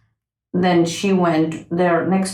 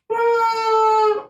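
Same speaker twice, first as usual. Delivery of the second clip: high sustained insect hum; droning high "mm"; midge's buzzing whine; 13 semitones higher than usual. Acted. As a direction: hold a high-pitched buzz like a flying midge. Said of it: "with this horrible tone".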